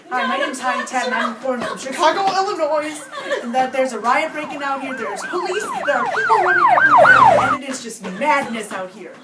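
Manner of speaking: loud, excited live reporting; frantic reporter. Acted. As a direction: report amid chaos, breathless.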